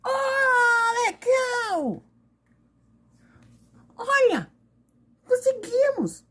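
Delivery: put-on high squealing triumphant kids; excited kid voices. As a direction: cheer that the prank worked. Kids celebrating the prank.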